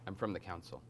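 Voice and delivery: very soft, secretive stranger; low-key, hushed disclosure; hushed confiding. Acted. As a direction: reveal it quietly. Derro confides who sent him.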